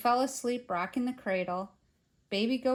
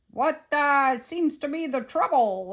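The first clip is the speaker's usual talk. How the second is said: loud, raised official query; policeman voice; 5 semitones higher, 6 dB louder than usual. A narrator's reading. Policemen arriving at the museum, loud official question. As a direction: ask it as an officer on a call.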